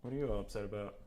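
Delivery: normal talking voice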